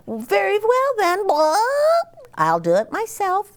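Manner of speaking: very high, clucky hen voice. Acted. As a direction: squeak it out like a busy little hen.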